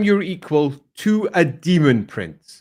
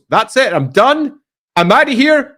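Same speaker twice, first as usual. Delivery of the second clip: louder, higher mock defiance; defiant exit; 7 semitones higher, 6 dB louder than usual. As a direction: announce a walkout, mic-drop style. Acting her walking out, mic drop.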